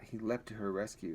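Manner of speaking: usual speaking voice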